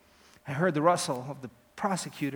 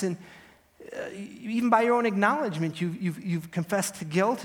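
lightly acted judge, louder and higher; judge's rebuke; 5 semitones higher, 5 dB louder than usual. Judge reminding defendant he turned counsel down, mildly exasperated rebuke. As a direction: stammer slightly through a raised reproach.

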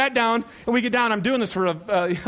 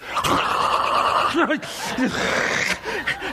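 long voiceless rushing-air noise; imitated wind roar; 7 semitones higher than usual. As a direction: blast out a drawn-out whoosh of wind. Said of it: "it goes".

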